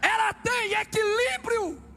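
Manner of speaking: very high, drawn-out preacher's shout; full-force preaching. Real, not acted. Preacher proclaiming the church's first quality, slow shouted cry. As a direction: stretch each word into a ringing cry.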